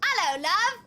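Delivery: high, loud British greeting; hammed-up British hello to a sweetheart; put-on British accent. Shown as a demonstration. Joking how Brits would react, exaggerated British accent. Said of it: "how would British people feel in England if I was like".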